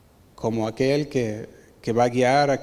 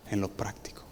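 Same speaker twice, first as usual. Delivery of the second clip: whispered; 5 semitones lower, 12 dB quieter than usual. Real, not acted. Pastor's advice trails off.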